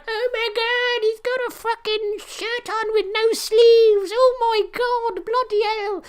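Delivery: high, slow gasping disbelief; mock shocked crowd. Imitating the crowd shocked at Vince's tank top, high and slow. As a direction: gush like scandalised fans.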